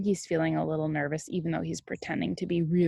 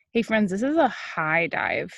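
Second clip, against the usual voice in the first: usual speaking voice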